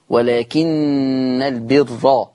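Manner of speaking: very slow ولكن البر with doubled nun and open ra; Quranic recitation. Shown as a demonstration. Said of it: "النون مشددة".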